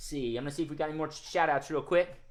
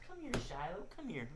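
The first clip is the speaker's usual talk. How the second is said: much softer gentle coaxing; 11 dB quieter than usual. Acted. Host coaxes the shy squirrel puppet, much softer. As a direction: coax a shy animal out quietly.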